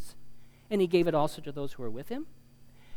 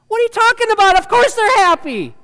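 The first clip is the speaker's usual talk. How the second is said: loud, high-pitched exclamation of disbelief; exasperated yell, pitched way up; incredulous outburst; 14 dB louder than usual. Acted. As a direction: shout it like the advice is absurd. Incredulous outburst at silly advice.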